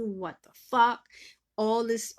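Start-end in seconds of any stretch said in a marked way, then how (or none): 0.0-1.1 loud, slow, exasperated swear; angry retold curse. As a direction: spit it out in mock fury. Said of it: "whatever curse can come out my mouth".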